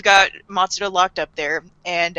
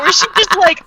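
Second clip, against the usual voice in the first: ordinary talk, nothing marked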